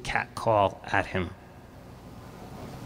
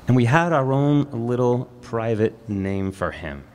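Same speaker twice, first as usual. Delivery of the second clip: ordinary talk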